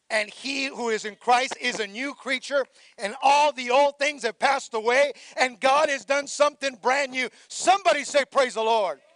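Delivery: building to a loud, high call; rising preaching. Acted. Pastor calling the church to praise, rising preaching. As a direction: climb into a shouted praise call.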